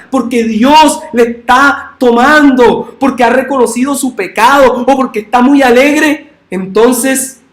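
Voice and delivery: high, loud preaching at full force; fiery preaching. Acted. Preacher rousing the church, loud and high. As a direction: preach it hard to the congregation.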